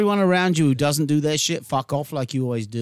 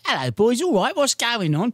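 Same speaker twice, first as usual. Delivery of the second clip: higher-pitched, hammed-up English greeting; exaggerated English accent; 6 semitones higher than usual. Shown as a demonstration. Showing how he used to sound back home, in exaggerated English accent. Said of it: "If I go back to my English way".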